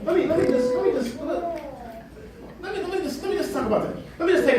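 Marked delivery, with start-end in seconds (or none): none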